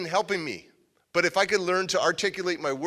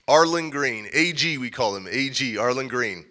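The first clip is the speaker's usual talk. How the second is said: usual speaking voice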